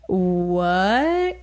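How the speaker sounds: long drawn-out "what" sliding upward; retold disbelief. Retelling finding hives in the mirror, in drawn-out disbelief. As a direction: stretch it out, rising in mock disbelief.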